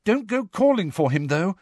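high-pitched urgent warning. A narrator's reading. Hermione warning Harry not to call out, urgent. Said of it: "Hermione said urgently".

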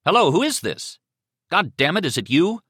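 loud, high, irritated demand; Beaumont barking. Acted. Man on phone challenges silent caller, barking. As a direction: demand to know who is calling. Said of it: "Beaumont's voice barked".

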